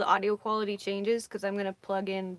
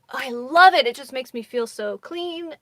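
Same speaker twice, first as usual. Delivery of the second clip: excited; 4 semitones higher, 14 dB louder than usual. Real, not acted. Gushing about the travel bidet.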